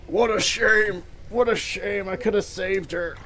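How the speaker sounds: a hint of an old man lamenting; old man mourning, lightly voiced. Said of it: "You see an old man say that".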